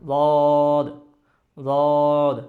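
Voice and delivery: "দদ" loud, held long on a level note; drawn-out Dad drill. Shown as a demonstration. Teacher leading practice, "দদ" long and loud from tongue edges. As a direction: stretch "দদ" from the tongue edges, closing at the end. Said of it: "আমরা practice করি একবার".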